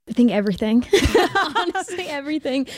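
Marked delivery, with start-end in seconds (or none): 0.9-1.5 bright laugh. Real, not acted. Laughing at the question. 1.5-2.7 laughing. Real, not acted. Laughing over the question.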